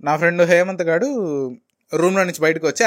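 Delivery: normal talking voice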